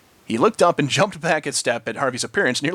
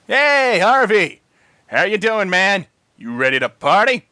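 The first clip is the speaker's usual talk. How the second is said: loud, hearty party call; 6 semitones higher, 5 dB louder, slower than usual. A narrator's reading. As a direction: hail him from outside. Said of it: "a familiar voice called out".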